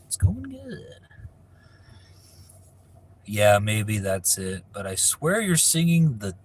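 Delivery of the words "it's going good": voiceless, breathy repeat of the line; put-on whisper. Answering a viewer again, now in a put-on whisper. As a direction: whisper it back like a secret.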